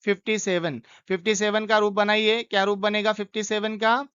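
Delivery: ordinary delivery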